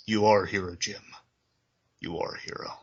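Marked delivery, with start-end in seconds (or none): 2.0-2.7 softly whispered repeat of the line. Retold or imitated. Wounded Gordon repeating his words to Batman, whispered. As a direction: breathe it out as a dying man. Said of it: "he says".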